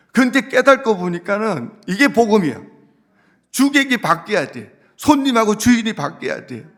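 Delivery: a pastor rousing his congregation, full force; preaching at full force. Acted. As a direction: drive the point home from the pulpit. Preaching climax.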